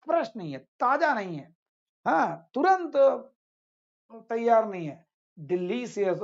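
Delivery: ordinary talk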